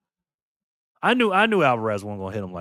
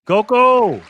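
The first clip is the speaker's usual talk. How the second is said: calling out; 10 semitones higher, 9 dB louder than usual. Real, not acted. Calling out a winner's name.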